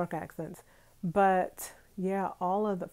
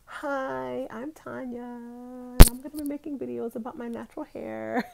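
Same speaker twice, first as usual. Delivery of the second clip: a lightly voiced younger self, higher and brighter; imitating her younger self; 5 semitones higher, 8 dB louder than usual. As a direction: mimic your old intro video, higher. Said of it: "where I'm like".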